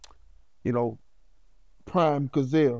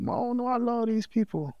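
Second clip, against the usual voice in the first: higher and softer, bewildered; bewildered confession; 8 semitones higher, 3 dB quieter than usual. Retold or imitated. Son telling his mama he loves these people, higher, softer, bewildered. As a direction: confess it like a scared boy. Retold call to his mom.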